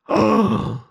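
high, enraged collective gasp; furious outcry. A narrator's reading. The tribunal erupts in an enraged cry. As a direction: gasp in outrage. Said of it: "иступлённо озлобленный крик".

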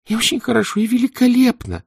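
put-on high squeaky girl voice; squeaky approval. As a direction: squeak it out, pleased. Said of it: "одобрительно запищала Вава".

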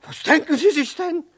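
high, loud affronted protest; indignant shout; offended outrage. Acted. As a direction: bristle at the insult, loud and high. Offended by rudeness.

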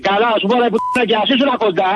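high-pitched furious threatening; furious victim threatening the prankster, pitched high; angry threat. Real, not acted. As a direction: threaten him through rising anger.

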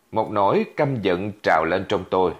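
plain reading voice

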